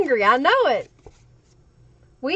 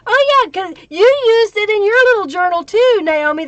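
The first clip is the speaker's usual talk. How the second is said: excited; 8 semitones higher, 7 dB louder than usual. Real, not acted. Reacting excitedly to a viewer.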